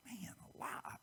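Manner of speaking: whispered disbelief, barely voiced; a hushed, amazed aside; awed whisper. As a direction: breathe it out in a stunned whisper. Listener's stunned reaction to teaching.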